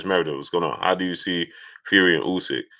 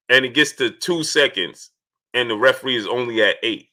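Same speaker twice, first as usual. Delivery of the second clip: indignant rant; 5 semitones higher than usual. Real, not acted. Ranting about slow referee count.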